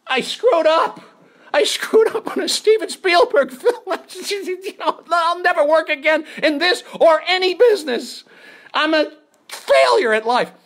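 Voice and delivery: high, loud wailing self-blame; hammed-up career-is-over lament; comic despair. Acting out his despair.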